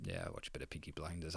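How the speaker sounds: low, very soft, fast mock mumble of the rival manager. Fan mimicking a rival manager, low soft quick mumble. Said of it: "him going".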